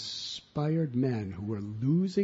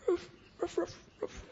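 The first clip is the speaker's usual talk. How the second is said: high little puppy yaps, much higher; yapping good dog; 5 dB quieter than usual. Retold or imitated. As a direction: yap like an eager small dog.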